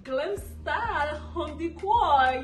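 soft, teasing big reveal; announcing big news. Real, not acted. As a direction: hush it, then drop the news slowly. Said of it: "I have some exciting news".

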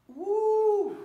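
high, loud burst of effort; excited whoop. Real, not acted. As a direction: let out a big whoop. Exertion cheer mid-workout.

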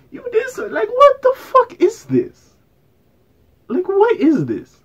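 high, stretched-out squeal of disbelief; shocked disbelief. Real, not acted. Disbelief at the video.

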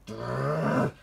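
rolled rumbling motor noise; menacing engine brrr; imitated engine rev. A narrator's reading. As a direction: trill it like a diesel revving up.

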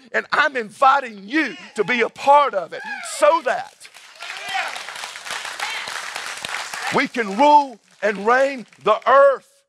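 high, driving sermon climax, phrase upon phrase; full-force preaching. Real, not acted. Preacher voicing the risen King to the church, full-force preaching.

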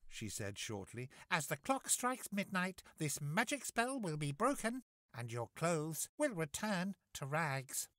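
high, kindly fairy voice; sweet magical godmother. Acted.

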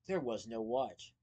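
plain reading voice

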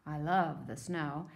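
ordinary talk, nothing marked

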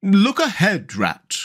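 abrupt alarmed call, dropping at the end; sudden cry. A narrator's reading. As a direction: cry out to alert a friend. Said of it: "cried the Mole suddenly".

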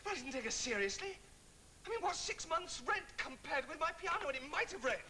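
high, agitated indignation; worked-up disbelief, pitch soaring; outraged protest. Acted. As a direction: protest as if scandalised. Outraged over piano left outside.

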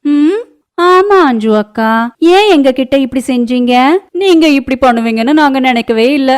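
high, loud, drawn-out neighbour voice; high-pitched needling neighbour; goading neighbour. Acted. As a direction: chime in with slow, pointed reproach. Second neighbour joins the complaint.

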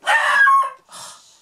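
huge, loud, exasperated sigh. Real, not acted. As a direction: let out a loud fed-up sigh. Dog pestering her all night.

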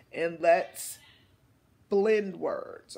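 ordinary talk

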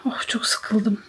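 very soft, bored whisper; weary whisper. Real, not acted. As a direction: sigh it out in a whisper. Bored sick woman in bed. (bıkkın bir fısıltı)

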